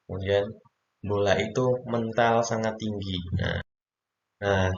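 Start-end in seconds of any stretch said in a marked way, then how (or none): none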